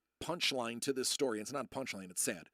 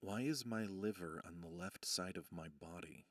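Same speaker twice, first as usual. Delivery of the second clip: quiet inner thought; 8 semitones lower, 8 dB quieter than usual. Retold or imitated.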